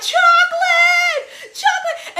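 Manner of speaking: slow high yelled chocolate; shouted quote. Retold or imitated. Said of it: "When he's like".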